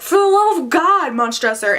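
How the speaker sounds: high, mock-desperate plea; hammed-up cry for mercy; pleading for help. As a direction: act out a victim begging, pitch leaping up at the start. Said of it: "he's, like, pleading for help".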